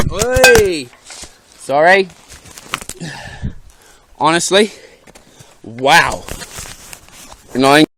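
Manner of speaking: talking around a phone in the mouth; loud, mouth clenched on the phone; phone held in teeth. Real, not acted. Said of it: "it's gonna be all muffled".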